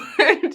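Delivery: high, loud giggle; amused laugh. Real, not acted. Aunt figure laughing at little cousin's boasting, high giggle. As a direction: burst out laughing fondly.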